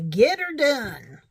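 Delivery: half-hearted Southern drawl, hammed up; mock Southern drawl. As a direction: play up a Southern twang on the catchphrase. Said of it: "I can't do a Southern drawl very well".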